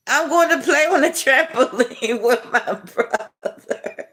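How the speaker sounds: high giggly reading of a sweet comment; laughing. Real, not acted. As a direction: giggle through the whole line. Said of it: "I'm laughing because you're so sweet".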